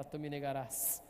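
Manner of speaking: slow, low and solemn, a hint of reproach; a quiet, knowing rebuke; Jesus to Peter. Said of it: "igual Jesus olha pra Pedro e fala". (Jesus repreendendo Pedro, lento e solene)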